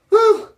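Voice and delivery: sudden high, loud whoop of release; real loud whoop. Real, not acted. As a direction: let out a wild whoop, fully real. Overwhelmed by his own story.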